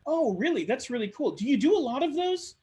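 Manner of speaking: high, keen surprised interest; impressed listener. Acted. Networker reacting to Sally's project, impressed. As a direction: show you're hooked on her story.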